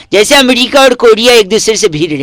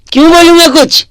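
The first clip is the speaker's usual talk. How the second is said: teasing; 6 semitones higher than usual. Acted.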